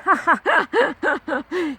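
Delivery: high peal of laughter; laughing. Real, not acted. Storyteller laughing at being caught unready, high real laughter. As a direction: burst into a long, high laugh.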